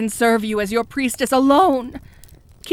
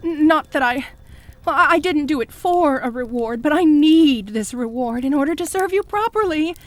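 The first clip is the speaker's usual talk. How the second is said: ordinary delivery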